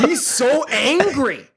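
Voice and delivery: loud, high, drawn-out exclamation; real excited emphasis. Real, not acted. Host reacts to the Rock's acting, loudly thrilled. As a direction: shout it slowly in amazement.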